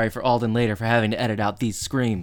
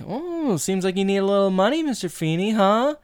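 high, fast, knowing tease; sly teasing; 7 semitones higher than usual. Retold or imitated. Boys needle their teacher about his money, sly and teasing. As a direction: dangle the bait like a smug kid.

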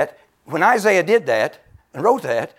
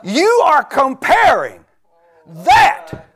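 loud, high, slow shouted rebuke; God's rebuke; 12 semitones higher, 8 dB louder, slower than usual. Retold or imitated. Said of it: "He said".

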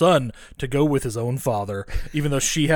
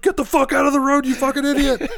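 high, loud mock yell; hammed-up outraged shout; imitated angry bystanders; 12 semitones higher, 5 dB louder than usual. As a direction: holler like a driver at someone in traffic. Retelling bystanders yelling at kid.

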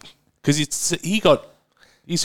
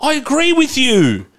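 exasperated; 9 semitones higher than usual. Real, not acted. Exasperated, defending himself in argument.

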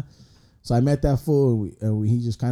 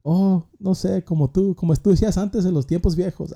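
higher, a playful imitation of a Spanish-speaking grandma; 7 semitones higher than usual. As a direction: voice the nosy grandma, higher pitched. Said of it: "she's like".

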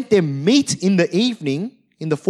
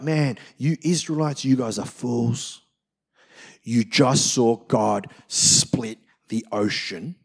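mock scolding; 8 semitones lower, 4 dB quieter than usual. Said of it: "I was like".

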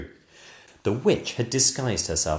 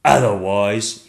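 slow, louder menacing dragon; dragon threat; 9 dB louder, slower than usual. Acted. Dragon threatening the kingdom, slow and menacing. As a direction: drag it out as a threat.

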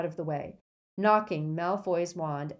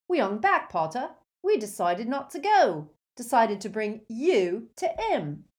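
higher, louder thuggish voice; gloating henchman; 5 semitones higher, 6 dB louder than usual. Acted. Crabbe gloats, childlike henchman voice.

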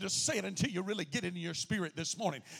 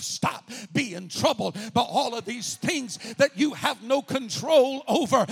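loud, higher, climbing to a shout; full-force preaching; 4 semitones higher, 11 dB louder than usual. Acted. Preacher rallying the congregation, shouting praise. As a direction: build into a praise cry.

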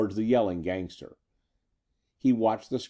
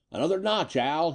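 high-pitched, lightly gleeful call; a touch of chortling glee, pitched up; mild chortle; 7 semitones higher than usual. A narrator's reading. Eddie calling to Al as he works the robot, mildly chortling. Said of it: "he chortled".

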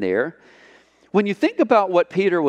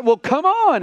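high, offhand "come on"; Jesus shrugging invite; 11 semitones higher, 3 dB louder than usual. Acted. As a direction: call his bluff, breezy and high. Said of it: "And Jesus is like".

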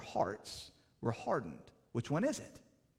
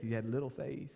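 normal talking voice

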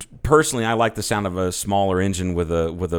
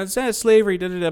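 high, far mocking whine; mocking whiny voice; 11 semitones higher than usual. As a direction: parrot the crybaby argument, mocking. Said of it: "you can make the argument".

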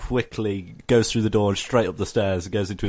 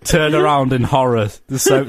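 usual speaking voice

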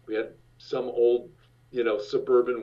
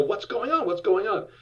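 high alarmed what's going on; startled dad asking twice in alarm; panicked question; 8 semitones higher than usual. Retold or imitated. Dad with baby asking his wife, high alarmed voice.

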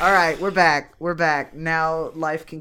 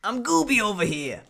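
Gooby voice; 4 semitones higher than usual. Pretend. Doing a Gooby character voice.

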